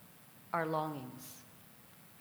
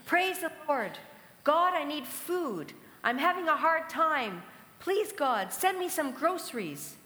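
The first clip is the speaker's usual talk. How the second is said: high, loud cry to heaven; raised voice asking for help; pleading shout; 8 semitones higher, 5 dB louder than usual. Retold or imitated. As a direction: call out your need to God from the porch. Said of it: "and shouted".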